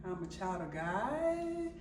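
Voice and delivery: soft, slow, questioning echo; imitating an unsure son, softly; hesitant child's reply. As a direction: drag it out into an unsure rising question. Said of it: "he was looking like".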